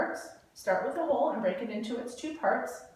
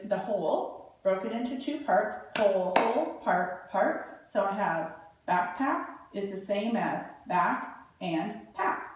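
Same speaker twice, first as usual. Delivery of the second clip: ordinary delivery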